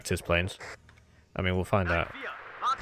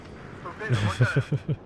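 higher, rising chuckle; amused chuckle; 4 semitones higher than usual. Real, not acted. Player laughing off a loss, amused chuckle. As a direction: chuckle on after the bad luck.

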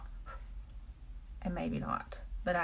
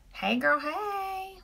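greeting; 12 semitones higher, much slower than usual. Real, not acted. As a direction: stretch the greeting high and slow. Greeting a viewer joining live.

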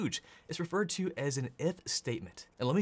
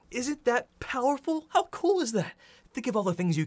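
high, loud squeal of delight; excited; 9 semitones higher, 5 dB louder than usual. Real, not acted. Teacher thrilled by the working code, squealing. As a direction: gush over it in a squeaky voice.

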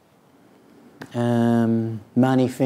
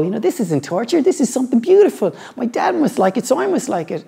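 put-on much higher, sweet little-boy voice; imagined child voice; 9 semitones higher, faster than usual. Father voicing his son's hoped-for words, childlike. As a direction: mimic a dutiful kid discovering the joy.

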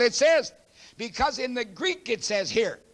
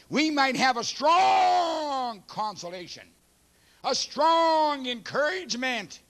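preaching climax; 5 semitones higher, slower than usual. Real, not acted. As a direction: swell each word on strong. Preacher climaxes on scripture reading.

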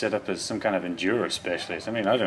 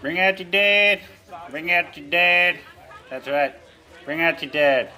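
town crier voice; 6 semitones higher, 9 dB louder than usual. Acted. Repeat of cart caller.

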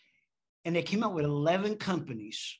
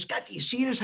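higher, pressing mock coach voice; dramatic mentor; 7 semitones higher, faster than usual. Mentor repeating the question to Scott, dramatic and pressing. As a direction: sell it like a huge discovery. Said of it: "he's very dramatic when he talks".

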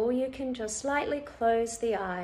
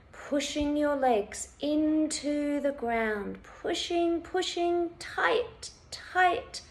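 urging to tense; 5 semitones higher than usual. Shown as a demonstration. Instructor urging listeners to push their legs down, urging to tense. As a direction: pitch climbing as effort builds.

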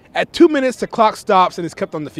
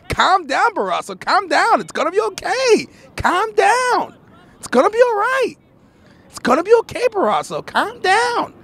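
high, sing-out pleading with dips on the name; mock calming; 10 semitones higher than usual. Commentator telling fired-up Barrasso to calm down, high mock pleading. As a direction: plead high, as if taming someone worked up.

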